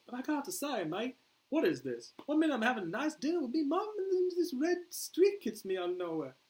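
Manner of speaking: high, loud Australian complaint; Australian accent. Acted. Boomer complains to Flag about capture, Australian accent. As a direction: protest in a put-on Australian accent. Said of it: "I can't do this accent".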